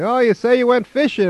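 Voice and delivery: suddenly eager, high and loud; light up at the word fishing; perked-up excitement. Retold or imitated. Grandfather suddenly keen on fishing talk, high and loud.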